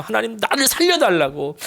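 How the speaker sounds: mild mock shout of "살려달라고" pitched high; crying out. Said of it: "소리를 지르는 거예요".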